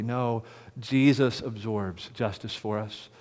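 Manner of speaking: ordinary talk, nothing marked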